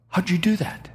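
high, astonished voice of an onlooker; retelling an astonished bystander; amazed question. Retold or imitated. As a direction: squeak it out in disbelief.

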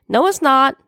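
high-pitched call of protest. A narrator's reading. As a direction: call out a quick contradiction. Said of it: "I called out".

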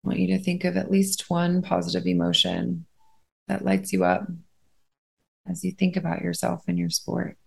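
unhurried, steady phrases, spaced out to let them breathe; guided meditation. Acted.

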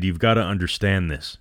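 plain reading voice

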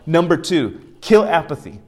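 loud, high exhortation; preaching at full force. Acted. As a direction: call it out to the congregation.

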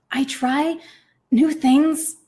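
panting while pushing a door; breathless, slow and strained; out of breath. Acted. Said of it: "I panted".

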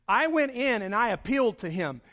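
normal talking voice

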